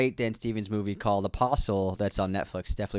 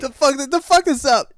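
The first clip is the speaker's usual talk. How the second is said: movie quote shout; 8 dB louder than usual. Pretend. Quoting movie line back.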